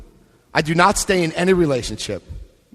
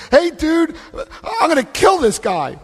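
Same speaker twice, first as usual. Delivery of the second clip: retelling a frantic call to the sponsor; 10 semitones higher than usual. Retold or imitated. To his sponsor on the phone, panicked high outburst. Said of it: "I'm like".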